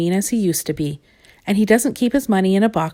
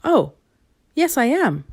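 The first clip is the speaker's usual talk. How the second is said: lying; 6 semitones higher than usual. Acted. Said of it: "lied Mister Mean".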